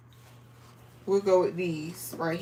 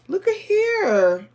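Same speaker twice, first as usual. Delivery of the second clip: excited; 10 semitones higher, slower than usual. Real, not acted. Showing viewers new fabric.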